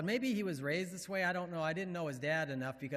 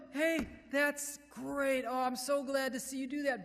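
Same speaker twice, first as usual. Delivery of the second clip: enthusiastic affirming; 8 semitones higher, 4 dB louder than usual. Acted. Parent affirming a child, high gushing praise. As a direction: cheer them on, stretching the words. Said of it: "affirm 'em".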